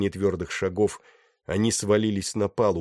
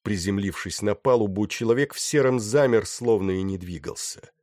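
ordinary delivery

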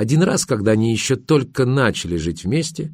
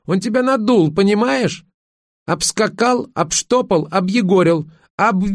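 very high, indignant tirade; angry squeal; 11 semitones higher than usual. A narrator's reading. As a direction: fire off each verb shrieky, then break off. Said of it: "взвизгнула Верка". (возмущённо, срываясь на визг)

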